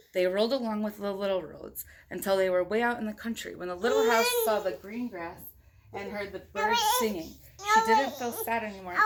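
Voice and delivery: put-on reading a picture book aloud to a child; steady storybook read-aloud; story narration. A narrator's reading. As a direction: tell it like a bedtime story. Parent reads picture book aloud.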